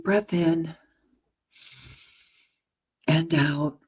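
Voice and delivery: unhurried breathing cues for the listener; guided meditation. Acted. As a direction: lead them gently into the breath. Leads a meditation.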